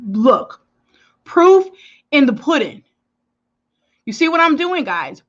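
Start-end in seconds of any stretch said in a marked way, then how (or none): 1.2-5.2 excited. Real, not acted. Excited showing result.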